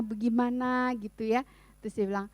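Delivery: usual speaking voice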